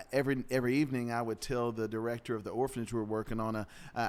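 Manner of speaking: normal talking voice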